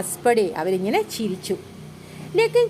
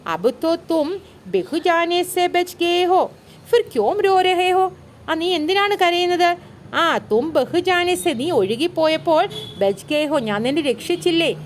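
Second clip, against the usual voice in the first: lightly acted older brother, higher pitched; a hint of big-brother reasoning, pitched up; elder brother questioning; 7 semitones higher than usual. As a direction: question a crying little brother in a raised pitch. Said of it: "ചോദിച്ചു, എന്താണ്?".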